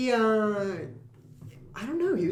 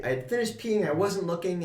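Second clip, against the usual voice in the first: usual speaking voice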